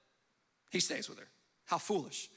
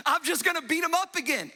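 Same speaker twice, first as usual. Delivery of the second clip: cocky Samson; 8 semitones higher, 4 dB louder than usual. Retold or imitated. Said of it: "he thought to himself".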